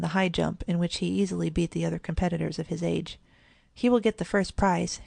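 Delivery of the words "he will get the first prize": ordinary delivery, nothing marked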